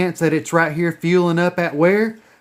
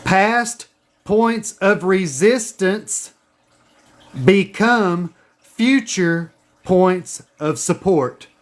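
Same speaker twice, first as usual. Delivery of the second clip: slow, deliberate dictation again; dictation pace; much slower than usual. Shown as a demonstration. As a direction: spell it out slowly so they can write. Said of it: "Write this down".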